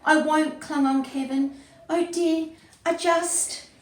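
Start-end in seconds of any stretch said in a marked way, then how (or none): 0.0-0.6 high, loud, panicky protest; frightened, raised-pitch narration voice; scared refusal. A narrator's reading. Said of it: "But Kevin was scared". 1.9-3.7 high, faltering worry; anxious, high-pitched hesitation; scared. A narrator's reading. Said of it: "But Kevin was scared".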